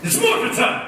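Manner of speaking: high, heroic rallying cry; catchphrase shout. Shown as a demonstration. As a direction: call it out like a transforming hero. Performs catchphrase for the crowd.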